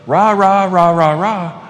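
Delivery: mocking pep-squad chant; mock cheerleading. Shown as a demonstration. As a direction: cheer it like an empty pep rally. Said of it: "not from a place of".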